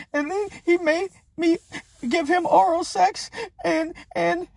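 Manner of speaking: high, put-on mocking woman's voice; mocking the accuser. Mocking a woman reading her statement, high put-on voice. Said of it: "she reading this shit off a piece of paper".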